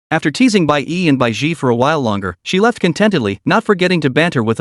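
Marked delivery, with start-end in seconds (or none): none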